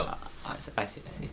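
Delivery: hushed, breathy I see; whispering. Real, not acted. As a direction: whisper it under your breath. Whispered sarcastic acknowledgment.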